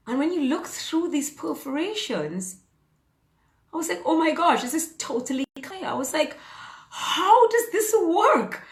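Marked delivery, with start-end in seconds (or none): 4.0-5.8 high, loud gasp of discovery easing off; acting out amazed surprise, pitched up; retold amazement. Retelling her amazed reaction at clinic. 6.9-8.6 high, loud, drawn-out wonder; re-enacted marvelling, pitched way up and slowed; retold amazement. Retelling her amazement at eye test.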